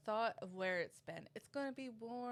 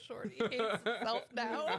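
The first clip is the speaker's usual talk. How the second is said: teasing through laughter; laughing; 5 dB louder than usual. Real, not acted. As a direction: say it while cracking up. Co-host laughing at his regret.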